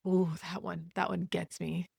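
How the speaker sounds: ordinary talk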